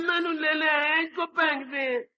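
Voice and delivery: put-on high, slow childish plea; a toddler pleading with his mother to drop the baby; whiny toddler. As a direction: beg like a jealous little boy. Jealous toddler begs mother.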